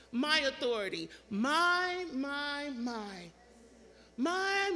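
each "My" leaned on, repeats stepping down; stress on MY. Acted. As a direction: claim ownership with every "My". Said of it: "It's a possessive pronoun".

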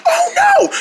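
panicked, very high wheezy squeak; squeaky, strained despair; lost voice. Acted. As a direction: wail through a screamed-out voice. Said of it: "you've really drained it".

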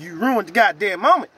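normal talking voice

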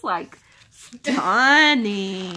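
STUNNING stretched very long and loud; awed drawn-out emphasis. Real, not acted. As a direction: draw out "stunning" slowly, pushing hard on the first syllable. Admiring the calendar artwork.

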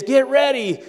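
put-on high hollered order to the kids; mock parent holler. Rushed parent hollering at the kids before church.

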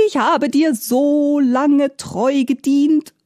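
high, slow wailing complaint; tearful-sounding theatrical lament, pitched high; sobbing reproach. A narrator's reading. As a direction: whine the reproach, drawing out the words. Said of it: "schluchzte die Gestalt".

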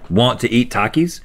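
normal talking voice

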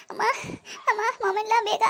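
high, strained voice crying out in pain; moaning in pain, pleading with her mother-in-law. Acted. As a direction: beg for help through stomach cramps. Acted stomach pain.

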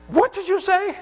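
high-pitched startled question; exactly imitated surprise. Patient answers the pastor's unexpected compliment, high surprised question. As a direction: ask it high, as if taken aback. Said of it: "That's exactly how he said it".

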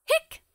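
short squeaky hiccup sound; a sharp little hiccup; high hiccup. Acted. Character has the hiccups.

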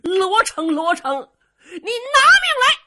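high, loud, slow battle challenge; furious shout. Acted. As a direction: bellow for his enemy's life. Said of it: "有人高声喊嚷".

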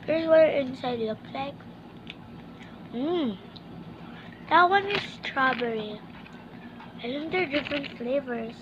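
talking between bites; chewing candy while talking. Real, not acted. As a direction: mouth full of candy. Seen 0: hand brings candy to mouth, then talks with full, moving mouth.

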